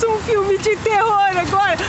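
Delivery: usual speaking voice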